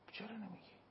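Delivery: sudden hushed whisper of a rhetorical question. Real, not acted. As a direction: drop to a breathy whisper, as if confiding.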